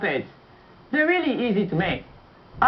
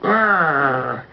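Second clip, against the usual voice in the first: low mock monster growl; playful growl; 5 semitones lower than usual. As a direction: growl like a puppet beast. Puppet wrestler growls.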